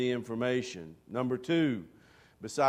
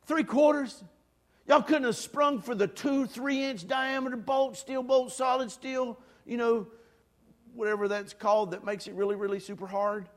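incredulous; 9 semitones higher than usual. Retold or imitated. Incredulous at the small bolt.

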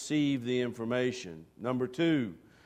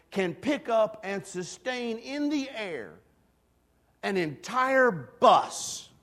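imitating Gabe's hype; 8 semitones higher, 5 dB louder, slower than usual. Preacher retells Gabe's big sales pitch.

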